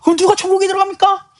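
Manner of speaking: high-pitched dismayed outcry; worried disciples. Retold or imitated. Disciples asking who can enter heaven, worried high cry. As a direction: ask it in alarm, voice shooting up. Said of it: "제자들이 근심해요".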